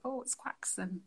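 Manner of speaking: QUAXON as "KWAK-sun", softly quoted; soft mimicked QUAXON with a hard x; teasing mispronunciation. People teasing him wherever he goes, soft mock QUAXON. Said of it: "people are like".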